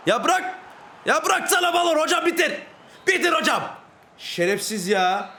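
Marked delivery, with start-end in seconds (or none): 0.1-3.6 very high, urgent shouts; frantic yelling. Real, not acted. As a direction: yell at the referee to end it. Pleading with referee and player. (telaşla, tiz bağırarak)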